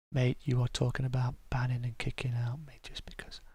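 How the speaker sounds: very quiet, whispery murmur; reading under breath. Real, not acted. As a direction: read it to yourself, barely voiced. Reading out a chat message.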